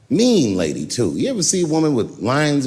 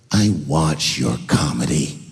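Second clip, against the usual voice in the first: much lower, slow ominous voice; menacing old lady; 10 semitones lower, 4 dB louder, slower than usual. Retold or imitated. The mean lady in the parking lot, low and slow. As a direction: lay the words down like a threat.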